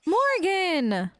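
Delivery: gasp, then a high, drawn-out reproach; shocked scolding. Pretend. Player to companion who insulted her dog, shocked scolding.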